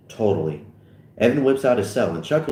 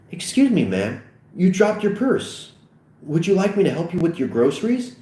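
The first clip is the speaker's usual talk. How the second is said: higher-pitched courteous offer; exaggerated politeness; 8 semitones higher than usual. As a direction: offer help with overdone courtesy. Seth politely approaches old lady.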